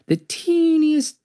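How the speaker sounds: put-on high, slow squeak on teeniest; tiny squeaky voice. A narrator's reading. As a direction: make the word teeniest sound tiny. Squeaky stretched word.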